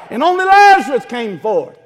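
loud, high, climactic preaching; full-force preaching. Acted. Preacher telling of the cross, at full shout. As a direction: build the sermon to a roar.

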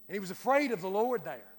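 ordinary delivery

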